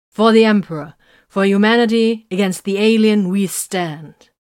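loud, solemn call-and-response oath, building; intoned oath. A narrator's reading. Warriors swear their oath to the Emperor, intoning loudly. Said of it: "Titus intoned".

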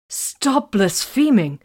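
high, loud, drawn-out scolding; shocked demand. A narrator's reading. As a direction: order it in scandalised shock. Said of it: "Vox demanded, even more shocked".

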